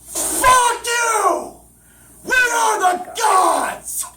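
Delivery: high, loud triumphant roar; booming shout. Acted. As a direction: roar it from the heavens. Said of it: "in an echoing sonic boom".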